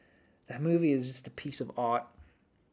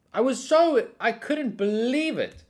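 indignant disbelief; 12 semitones higher, 7 dB louder, slower than usual. Real, not acted. Indignant about no gift.

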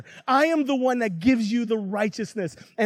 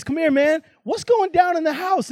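higher, casual acted questioning; pitched-up, puzzled older brother; summoning a servant; 8 semitones higher than usual. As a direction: call someone over to find out what's up.

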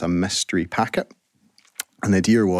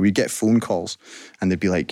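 usual speaking voice

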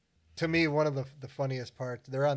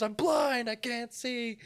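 panicked victim; 10 semitones higher, 3 dB louder, slower than usual. Retold or imitated. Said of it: "He goes".

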